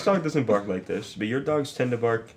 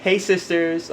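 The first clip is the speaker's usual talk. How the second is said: Edward Caesar; 5 semitones higher, slower than usual. Retold or imitated. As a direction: mimic a classmate's high greeting. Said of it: "like, kinda like that ish".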